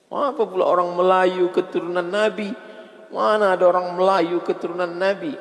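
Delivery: high, loud, scornful rhetorical questions; preaching at full force. Acted. Preacher scoffing at the congregation, loud and high. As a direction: rail against the claim from the pulpit.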